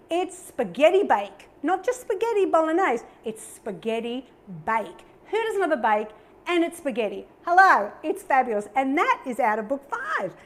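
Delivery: ordinary delivery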